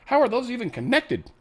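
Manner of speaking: imitated bewildered tech worker; baffled disbelief. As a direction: act out puzzling over a broken system. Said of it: "and you're like".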